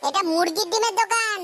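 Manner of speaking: mock the customer's silly question; sarcastic snap. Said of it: "control, Madan".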